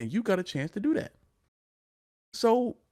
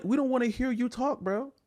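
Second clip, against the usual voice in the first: ordinary delivery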